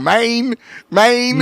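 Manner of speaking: high-pitched teasing echo of a co-host; mocking imitation. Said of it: "It almost sounds like you're going".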